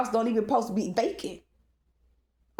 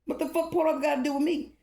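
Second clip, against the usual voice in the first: 1 retold angry retort; 7 semitones higher, faster than usual. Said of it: "I said".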